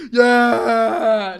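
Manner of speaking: loud, long wailed yeah; mock cheer. Real, not acted. Streamer celebrating being cooked by her sister, mock cheer. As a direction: holler it out at length.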